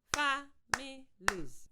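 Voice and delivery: Families stretched slowly, fa-mi-lies; slow syllable clapping. Shown as a demonstration. Teacher modelling for pupils: slow syllable-clapped Families. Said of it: "Listen first".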